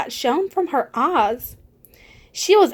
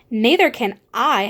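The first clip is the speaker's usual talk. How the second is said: usual speaking voice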